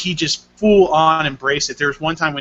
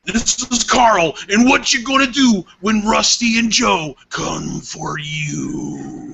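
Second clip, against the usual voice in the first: higher, drawn-out wrestling promo voice; mock wrestler; 6 semitones higher, slower than usual. Host threatening listeners with Rusty and Joe, mock promo voice. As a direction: play a hammed-up wrestler throwing out a challenge.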